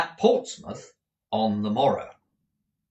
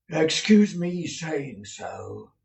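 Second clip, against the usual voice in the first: confiding manager; 7 semitones higher, 4 dB louder, much slower than usual. A narrator's reading. Manager leans in.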